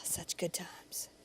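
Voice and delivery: breathy whispered aside, slow; wistful whisper. Pretend. Host whispers fondly about the old ad. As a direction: sigh it out in a whisper. Fond sigh.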